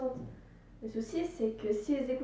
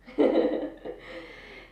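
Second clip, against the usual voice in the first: real laugh; 3 semitones higher, 5 dB louder than usual. Real, not acted. Laughing at his own accent.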